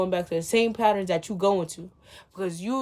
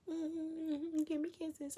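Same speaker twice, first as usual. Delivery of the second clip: cutesy; 9 semitones higher, 11 dB quieter, much slower than usual. Pretend. High soft cutesy.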